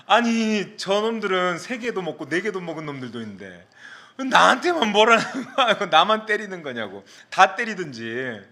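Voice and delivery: high-pitched mock indignation with a laugh; aggrieved protest. Student singled out for a smack, protesting with comic grievance. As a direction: re-enact a wronged student's whiny complaint. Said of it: "너무너무 억울하고 너무너무 속상하고".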